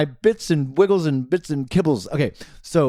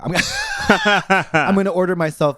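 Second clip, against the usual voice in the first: laughing; 5 semitones higher, 5 dB louder than usual. Real, not acted. Giggling mid-line.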